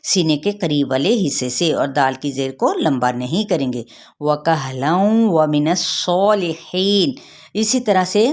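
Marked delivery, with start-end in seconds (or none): 4.2-7.1 slow chanted verse, with a heavy saad; slow model recitation of the verse ending; Quranic recitation. Shown as a demonstration. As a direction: recite व मिनस सॉलिहीन slowly. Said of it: "इस तरीके से अदा करेंगे".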